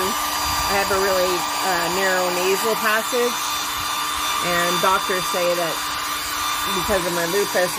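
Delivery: talking loudly over dryer noise; over hair dryer. Real, not acted. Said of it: "so please watch your ears".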